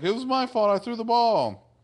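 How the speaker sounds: very high mock youngster voice; confessing kid. One guy owns up to the mediators, pleading. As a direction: blurt a confession in a put-on high pitch.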